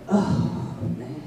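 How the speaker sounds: soft, breath-heavy retold groan of dismay; dismayed sigh. Worker facing a return to mental health, dismayed. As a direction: let the words sag out in a sigh.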